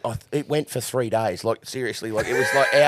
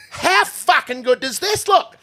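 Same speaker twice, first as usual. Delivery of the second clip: proud excited exclaim; 8 dB louder, slower than usual. Retold or imitated. Proud of his straw box.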